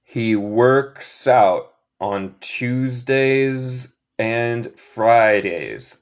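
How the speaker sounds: very slow, each word set apart; slow repeat-after-me model. Shown as a demonstration. Teacher modelling a sentence for students to repeat, very slow. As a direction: stretch out every word for learners to copy. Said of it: "Repiten después de mí".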